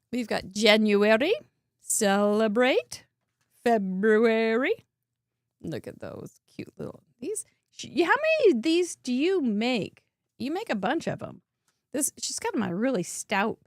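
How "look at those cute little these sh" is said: soft, low whisper over tiny cute cards; whispered cooing. Real, not acted. Host cooing at cute little cards, whispered.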